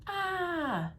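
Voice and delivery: long, high-pitched frightened "ah"; performed scream of surprise; startled yell. A narrator's reading. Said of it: "The man yelled".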